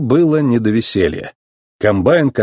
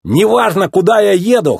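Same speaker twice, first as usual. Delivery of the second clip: high-pitched cocky brag; gleeful bragging, pitched up; boasting; 9 semitones higher than usual. A narrator's reading. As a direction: show off proudly. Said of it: "хвастался он".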